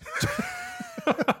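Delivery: high squealing laugh; a sudden shrill laugh; burst of laughter. Real, not acted.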